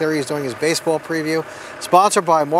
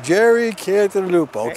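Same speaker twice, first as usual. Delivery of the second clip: mock announcer name call; 3 semitones higher than usual. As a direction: stretch the name like a game show host. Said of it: "I walk around going".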